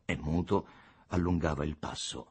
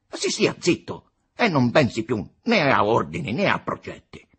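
raised, loud impatient order; scornful exasperation; 7 semitones higher, 9 dB louder than usual. A narrator's reading. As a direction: shut him up with disdain. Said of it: "con furioso gesto di sdegno". (ordine sdegnato e spazientito)

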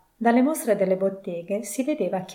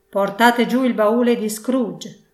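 loud, drawn-out shouted order; terrible shouted call; 2 semitones higher, 6 dB louder, slower than usual. A narrator's reading. Schoolmaster crying out in the courtyard, terrible loud voice. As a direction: call it out loudly across a courtyard. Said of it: "Una terribile voce gridò".

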